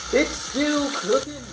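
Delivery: slow, high-pitched marvelling; drawn-out repeat. Pretend. Vlogger marvels that bacon is still cooking, drawn out.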